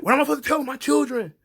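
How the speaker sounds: high, loud, fast hammed-up despair; mock despairing plea. Roman agonizing over his kids, high hammed-up despair. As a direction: wail it like a tragic hero. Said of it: "Roman's like".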